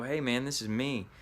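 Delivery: ordinary talk, nothing marked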